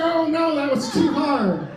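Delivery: high, loud, panicked cry of a rattled magician; panicked mock yelp. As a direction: squeal it out like a frightened showman. Scared magician, audience laughs.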